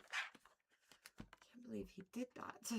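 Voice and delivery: slow hushed whisper of disbelief; whispered aside. Real, not acted. Streamer whispering hurt disbelief about Giraffe. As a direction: whisper it slowly to yourself.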